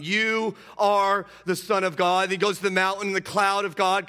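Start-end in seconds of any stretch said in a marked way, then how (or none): 0.0-2.2 loud, high, slow mock shout; demons shouting. Preacher voicing demons shouting, loud and slow. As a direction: cry it out like the demons.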